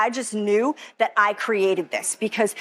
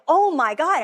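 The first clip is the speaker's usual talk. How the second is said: amazed; 6 semitones higher than usual. Retold or imitated. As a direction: gasp it out as if it just hit you. Retold ecstatic feeling after healing.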